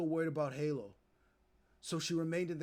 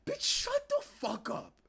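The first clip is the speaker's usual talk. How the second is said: very high-pitched, drawn-out exasperation; exasperated outburst; 3 dB louder, slower than usual. Acted. Streamer rebuts the mother's story, exasperated outburst. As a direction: snap back in a high, stretched squawk. Streamer snaps back at Holly's claim.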